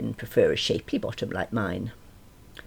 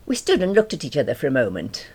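ordinary delivery, nothing marked

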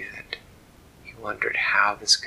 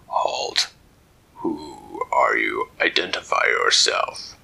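low, slow creature challenge; a stern armored sentry; deep lobster guard; 8 semitones lower, slower than usual. Acted. Lobster guard stopping the intruder, low stern voice.

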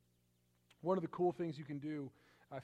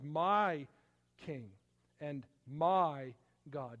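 each word slowed and weighted, louder; slow solemn emphasis; 2 semitones lower, 4 dB louder, much slower than usual. Acted. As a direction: lean hard on my King and my God. Repeating David's words slowly.

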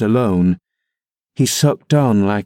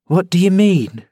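puzzled question; 8 semitones higher than usual. A narrator's reading. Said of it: "asked Zillah".

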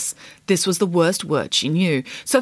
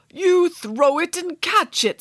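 king; 10 semitones higher, slower than usual. Acted. The King explains.

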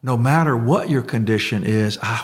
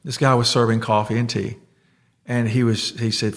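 ordinary talk, nothing marked

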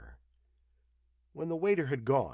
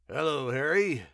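loud, hearty hello; exclaimed greeting; 4 semitones higher, 6 dB louder than usual. A narrator's reading. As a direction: call out to an acquaintance in greeting. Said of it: "he exclaimed".

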